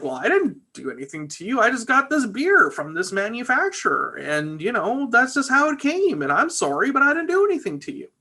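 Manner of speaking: defensive bar guy. Retold or imitated. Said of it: "the bar guy is like".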